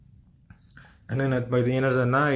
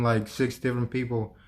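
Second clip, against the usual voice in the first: ordinary talk, nothing marked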